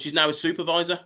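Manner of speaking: usual speaking voice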